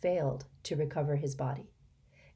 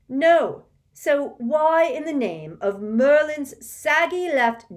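very loud, high furious outburst; furious rant; 10 semitones higher, 11 dB louder, slower than usual. Acted. Ron rants at his mother, very loud and furious. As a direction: let rip in fury.

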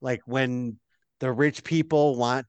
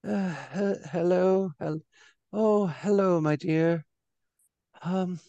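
put-on sleepy old lady; 4 semitones higher than usual.

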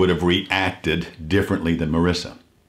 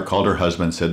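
ordinary talk

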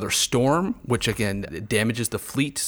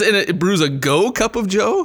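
laughing read-out; 9 semitones higher, 5 dB louder than usual. Shown as a demonstration. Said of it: "It says".

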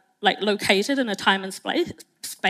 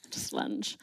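whispered; 7 dB quieter than usual. Real, not acted. Trailing aside.

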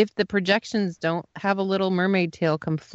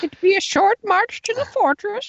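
put-on gruff old fisherman; 12 semitones higher than usual. Quoting pirate ride narration.

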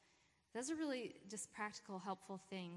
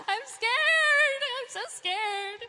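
much higher and louder, hammed-up fright; mock terror; 17 dB louder, much slower than usual. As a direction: squeal it like a comic coward. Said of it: "and you're like".

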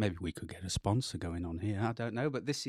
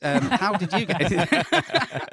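mock interviewer voice; 8 semitones higher, 9 dB louder, slower than usual.

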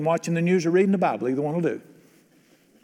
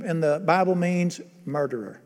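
ordinary delivery

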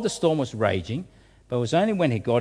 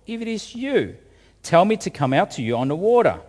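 high, louder eager call across the water; 6 semitones higher, 4 dB louder than usual. Retold or imitated. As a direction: impulsive Peter calling to Jesus, faster. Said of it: "he calls out".

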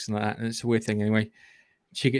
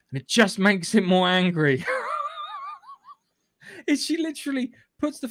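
laughing in disbelief as it ends; 14 semitones higher than usual. Real, not acted. Reviewer cracking up at the unkillable predator, laughing.

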